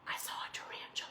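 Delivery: hushed storyteller's reveal; dramatic whisper. Retold or imitated. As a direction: whisper it quickly, like a dreaded secret.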